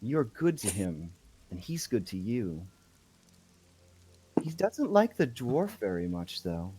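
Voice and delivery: lightly voiced woman, lingering pauses between lines; a hint of an eerie lady character; odd woman's role. Woman speaking for the doll Buddy, lightly voiced odd lady. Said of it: "And she says".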